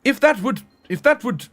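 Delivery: put-on upper-class English, starting high and settling; upper-class British accent. Shown as a demonstration. Streamer offering chat a whole stream like this, mock upper-class British accent. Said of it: "one could sit here and talk like this".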